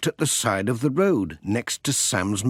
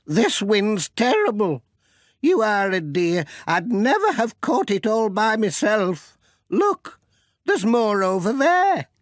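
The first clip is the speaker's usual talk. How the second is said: fussing, grateful grandmother; Granny Dryden's voice; 10 semitones higher than usual. Acted. Old lady thanking the postman for chasing her washing, high and fussing. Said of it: "said Granny Dryden".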